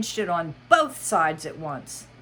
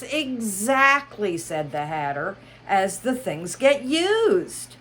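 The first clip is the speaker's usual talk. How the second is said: Mad Hatter voice; 4 semitones higher, 4 dB louder, slower than usual. Acted. Hatter speaking.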